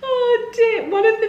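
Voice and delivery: high, teary laugh on the words. Real, not acted. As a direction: sigh it out through laughter. Recovering from laughing fit.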